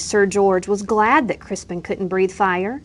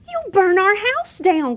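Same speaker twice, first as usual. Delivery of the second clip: crying out; 11 semitones higher than usual. A narrator's reading. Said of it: "cried his mother".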